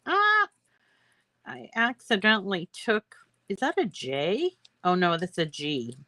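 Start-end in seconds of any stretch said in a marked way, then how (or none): 0.1-0.5 startled ah. Real, not acted. Slip while weeding letters.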